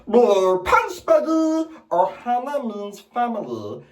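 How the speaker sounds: high, raspy alien creature; squeaky alien blurting; Stitch. Retold or imitated. Alien pet insisting on family, high rasp. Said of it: "Stitch from Lilo and Stitch".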